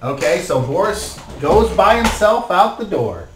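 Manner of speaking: ordinary talk, nothing marked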